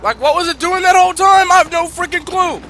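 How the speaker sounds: angry rant. Real, not acted. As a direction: vent at the broken weapon. Ranting about lock-on.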